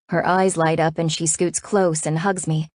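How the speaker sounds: ordinary delivery, nothing marked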